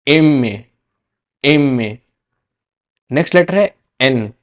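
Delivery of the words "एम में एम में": एम में as Spanish "eme"; Spanish letter name. Shown as a demonstration. Teacher to students, careful Spanish letter name. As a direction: model एम में clearly. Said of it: "एम को क्या कहते हैं".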